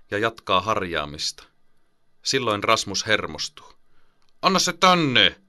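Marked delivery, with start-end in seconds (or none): 4.4-5.4 put-on high, loud child's demand; commanding. Boy angrily demands the toothpaste from his big brother, loud. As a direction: order him to hand it over. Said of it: "hän komentaa".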